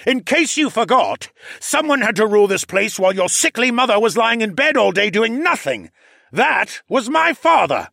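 furious high-pitched tirade; angry yelling. Acted. Huxley keeps yelling at Callan.

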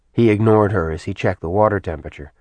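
plain reading voice

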